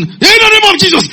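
high, loud shout of a frightened believer; mock shouting. Believer facing a demon possessed person, shouting high and loud. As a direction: shout it like bluster before a demon. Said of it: "We are hearing you shout".

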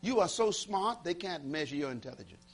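ordinary talk

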